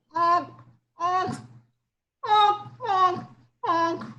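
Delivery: high nasal honking; stuffy nose honk. A narrator's reading. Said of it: "sound like they have stuffy noses".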